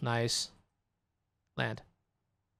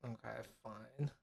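much softer, lower resignation; real deflated resignation; resigned mutter; 6 semitones lower, 17 dB quieter than usual. Real, not acted. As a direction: let it go under your breath. Resigned after the loss.

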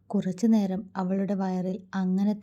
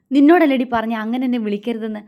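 much louder, higher angry yell; furious shout; 4 semitones higher, 11 dB louder, faster than usual. A narrator's reading. Siva shouting at Mithra in fury. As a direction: snap it at her in rage. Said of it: "ദേഷ്യത്തോടെ".

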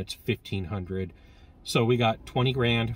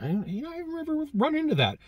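mock doubtful aside. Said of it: "and I'm like".

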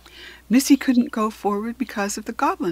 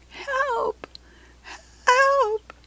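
tiny high, drawn-out cry for help; scared little voice. A narrator's reading. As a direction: call like a frightened little creature from far away. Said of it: "a scared little voice".

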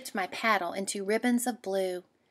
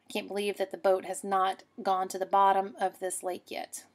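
normal talking voice

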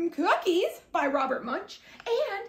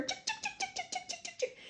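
high, much softer tick, tick; tiny tick sounds; 12 semitones higher, 13 dB quieter, faster than usual. A narrator's reading. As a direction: tap out tiny ticks like sugar grains. Sprinkling sugar.

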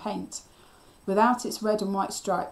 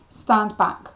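louder, commanding tone; firm order; 2 semitones higher, 8 dB louder than usual. A narrator's reading. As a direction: order someone out of danger. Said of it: "I ordered Cliff".

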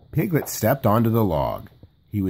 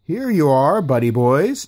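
cheerful call; 5 semitones higher, 5 dB louder than usual. A narrator's reading. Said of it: "Tigger cried".

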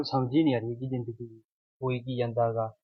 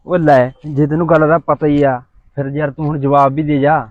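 insistent loud; 9 dB louder than usual. Acted. Boy pressing girl for answer.

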